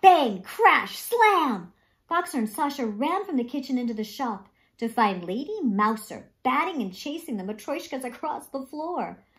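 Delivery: usual speaking voice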